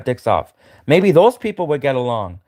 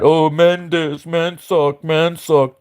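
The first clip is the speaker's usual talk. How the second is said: slow, whiny sneering parody; 2 semitones higher, much slower than usual. Retold or imitated. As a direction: drawl the complaints like a scornful imitation of a pop star. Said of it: "writing all of these songs that are anti-man".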